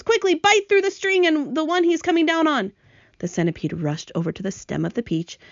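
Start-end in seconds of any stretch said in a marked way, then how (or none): 0.0-2.7 high, urgent command; yelling. A narrator's reading. James ordering the centipede to cut the string, yelling. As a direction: give the order urgently in a raised voice. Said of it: "yelled James".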